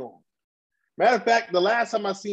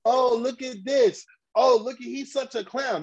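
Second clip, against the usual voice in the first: mocking gloat; 7 semitones higher than usual. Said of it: "You went and saw".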